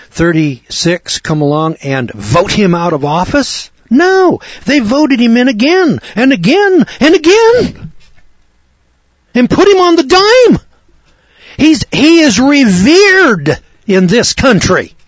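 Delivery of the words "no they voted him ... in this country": high, loud, exasperated cry; indignant outburst. Real, not acted. Radio preacher answering his own question about voters, indignant. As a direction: thunder it out in disbelief at the voters.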